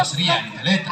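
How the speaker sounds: very low puppet voice; Aragoz puppet. Acted. Puppet repeating the host's lesson, Aragoz puppet voice. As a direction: echo it as a puppet, far below your own pitch. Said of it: "الأراجوز ده وهو بيرغي".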